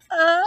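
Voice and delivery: high, loud breathy sneeze build; sneeze lead-in. Acted. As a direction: gasp into a sneeze. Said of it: "he said with surprise".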